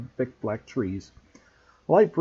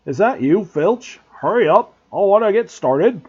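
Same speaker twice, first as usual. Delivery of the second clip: loud, higher call from afar; distant shout; 7 semitones higher, 9 dB louder than usual. A narrator's reading. Hagrid calling out to Filch, shouting. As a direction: bellow across the dark grounds. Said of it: "a distant shout".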